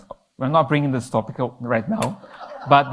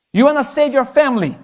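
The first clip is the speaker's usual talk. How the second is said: higher and louder, rousing question; forceful preaching; 9 semitones higher, 5 dB louder than usual. Acted. Preacher challenges the youth to save their family, rousing and loud. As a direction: challenge them, full voice.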